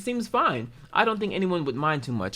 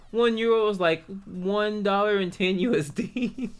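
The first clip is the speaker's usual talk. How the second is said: usual speaking voice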